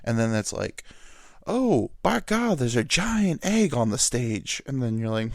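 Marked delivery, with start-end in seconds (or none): 1.5-4.6 slow, drawn-out mock announcer call starting high; Southern announcer. Announcer spots a giant egg on stage, mock astonished Southern call. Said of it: "who's, like, the Southern Oklahoma guy".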